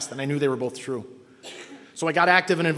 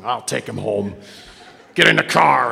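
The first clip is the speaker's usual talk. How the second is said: put-on lower, gruff old-timer talking round a toothpick; old man with a toothpick; 4 semitones lower, 6 dB louder than usual. Old sponsor ordering the kid into his car, low and gruff. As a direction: growl it like a crusty old sponsor. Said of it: "he had this toothpick all the time".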